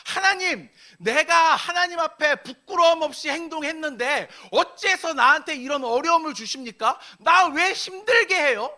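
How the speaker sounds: loud, high-pitched, indignant challenge; forceful protest. Acted. Job confronting God over undeserved suffering, forcefully protesting. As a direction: confront God at full force, demanding answers. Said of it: "강하게 하나님께 따지는 거예요".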